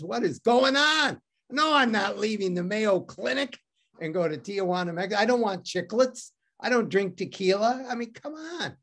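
high, disbelieving retort, slowing down; incredulous disbelief. Retold or imitated. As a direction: scoff at an absurd suggestion. Retorting to doctor's Tijuana advice.

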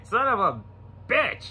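rising incredulous curse; amazed. Retold or imitated. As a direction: let it climb in disbelief. Amazed exclamation at sight.

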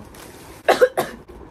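sudden coughing fit from choking. Real, not acted. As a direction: cough hard after swallowing wrong. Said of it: "Tá me engasguei".

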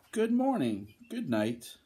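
high-pitched little dragons greeting back; small storybook creatures answering in chorus style; little dragons' reply. Acted. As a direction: pipe up high on "morning", then drop.

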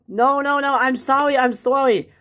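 high, pleading voice; panicked apology. Acted. Worker apologizing to the yelling boss, panicked. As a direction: plead to keep the job.

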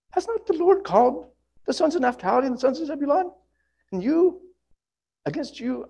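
high-pitched woman's voice; lady's voice. Acted. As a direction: voice Deborah up in a high register. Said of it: "apologize for my lady's voice".

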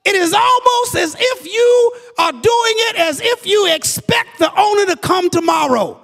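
high, loud climactic preaching; shouted, rising sermon climax; full-force preaching. Acted. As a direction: build the sermon to a roaring peak.

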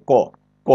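ক said crisply with no puff of air; teacher models a clean unaspirated ক; unaspirated ka demo. Shown as a demonstration. Teacher modelling for students, crisp unaspirated sound. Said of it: "আমার সাথে উচ্চারণ করে ক".

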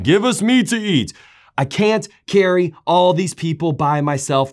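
high, loud desperate complaint to God; exasperated outcry. Acted. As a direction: cry out at the end of your rope. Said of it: "I love this next part".

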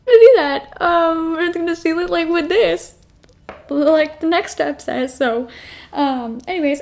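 hyped-up squeal of relief; overexcited outburst. Real, not acted. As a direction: blurt it out in a giddy rush. Loud after calling herself dramatic.

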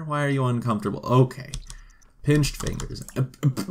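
normal talking voice

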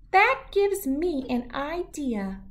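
high, slow, knowing little voice; a tiny shrew savoring his plan. Acted. Shrew realizes the tar will seal his boat, slow high voice.

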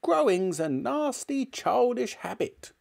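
high, disgusted granny voice; snappish grandmother. A narrator's reading. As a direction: scold him as a cross old woman. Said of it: "she snapped".

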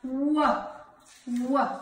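"w" said as a drawn-out wuh sound; letter sound demo. Shown as a demonstration. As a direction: sound out "w" as wuh, each on its own. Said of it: "our W says".

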